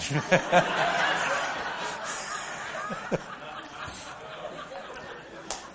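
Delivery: quiet breathless chuckle; soft airy laugh. Real, not acted. Pastor chuckling softly about the deacon's look. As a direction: laugh under your breath.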